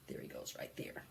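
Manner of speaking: breathy hush, pointing him out; whispered aside. Acted. Librarian whispering to kids to spot the fly.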